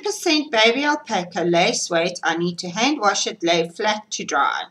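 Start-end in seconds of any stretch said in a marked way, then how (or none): none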